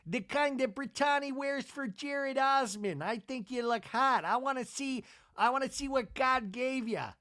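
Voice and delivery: mock-leering husband on a FaceTime call; imitating Seth. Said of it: "waiting for Seth to pop up".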